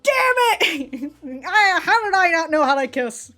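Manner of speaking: loud, high-pitched exaggerated self-reproach; mock frustrated outburst. Imagining him kicking himself over the kiss, frustrated. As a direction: wail at yourself in pretend exasperation. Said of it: "he'd probably go back home".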